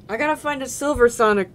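ordinary delivery

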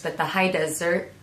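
ordinary delivery, nothing marked

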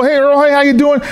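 imitating an overfriendly, high-pitched hello; chirpy greeting. Acting out third interrupting person.

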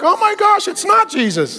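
mock astonished family; mock shock. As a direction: play wife and kids pretending to be stunned. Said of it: "the wife and the kids are like".

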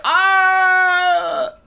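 high, loud shrieking laugh burst. Real, not acted. Gamer squeals at the game, laughing shout. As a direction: let out a squealing laughing yell.

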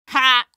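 loud short scornful laugh; scoffing ha. Acted. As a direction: bark out a mocking ha.

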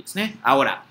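ordinary talk, nothing marked

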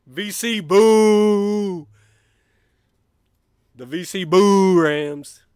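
loud, slow V C boo; mocking pun. Real, not acted. Fan mocking VCU with a boo pun, drawn out. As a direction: drag out the boo to mock them. Punning mock.

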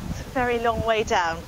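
soft, uneasy murmur; hushed unease. Real, not acted. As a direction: quietly take in a frightening drop. Peering down, scared of heights.

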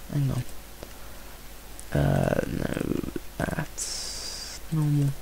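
whispered under the breath, slow; hushed whisper. Real, not acted. Gamer whispering to himself while checking the screen, hushed. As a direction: breathe it like checking something quietly.

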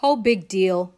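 put-on unimpressed, dismissive kid; bored. A narrator's reading. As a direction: shrug it off with a loud bored sigh. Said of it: "who gave a bored yawn".

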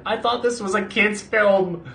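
high, slow, incredulous quote voice; mock disbelief. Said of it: "and I was like".

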